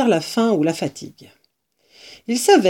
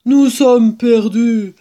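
slow, heavy admission of defeat; dramatic, drawn-out despair; despairing lament; 3 semitones higher, 5 dB louder, slower than usual. Acted. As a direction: draw it out as if all is lost. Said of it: "dit-il doucement".

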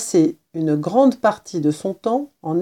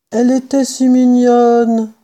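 plaintive murmur; 5 semitones higher, 6 dB louder than usual. A narrator's reading. Kitten mourns the little mouse, plaintive. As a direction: lament it, stretching the last word. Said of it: "murmura Sansonnet".

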